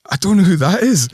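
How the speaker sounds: high, loud, laughing bewilderment; baffled protest. Retold or imitated. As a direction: protest that you have no clue, voice high. Acting baffled reply to impersonation.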